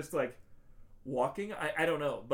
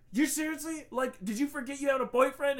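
high, louder acted disbelief; mock indignant; 11 semitones higher, 6 dB louder than usual. Host voicing Bobby confronting his girlfriend, mock indignant. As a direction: confront her with hammed-up indignation.

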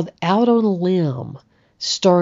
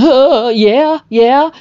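flustered reply; 7 semitones higher, 7 dB louder, much slower than usual. Retold or imitated. Acted flustered reply to handsome guy.

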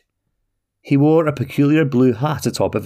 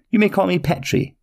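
high, eager little man offering his nickname; little man's voice; 9 semitones higher than usual. Acted. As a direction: pitch it high and friendly, a small odd traveller. Petrie gives short name.

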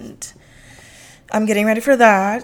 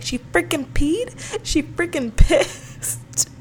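put-on high, slow little-kid voice breaking into a laugh; toddler voice; 6 semitones higher, slower than usual. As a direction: mimic the toddler while cracking up. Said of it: "Rumi's like".

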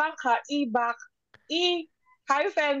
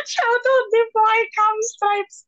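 excited shout-out; 7 semitones higher, 4 dB louder than usual. Acted. Playful listener shout-out.

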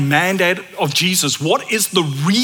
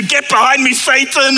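mock shout; 5 semitones higher, 4 dB louder than usual. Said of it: "we've gone from Messiah to".

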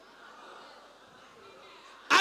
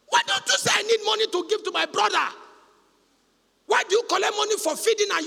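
high, loud preaching shout at the sister; 11 semitones higher, 6 dB louder than usual. Acted. Preacher scolding a sister who lied, loud shout. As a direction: call her out at full force.

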